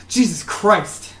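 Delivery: slow, loud startled oath; startled villager. Acted. Villager who jumps back from a magic pop, startled. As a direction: exclaim it as if jumping back in shock. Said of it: "like, startled".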